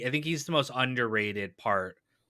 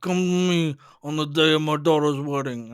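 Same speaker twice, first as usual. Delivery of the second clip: louder jaw-out Godfather rasp; Brando impression; 5 semitones higher, 5 dB louder than usual. Shown as a demonstration. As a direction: push the jaw out and do the Don. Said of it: "I don't know, it probably sucked".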